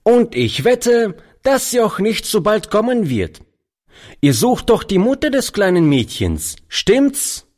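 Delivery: höhere, keck fragende Jungenstimme; frecher Gassenjunge. A narrator's reading. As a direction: wie ein vorlauter Straßenjunge herausfordern. Boy voiced in story. (Gassenjunge spricht den Alten keck an)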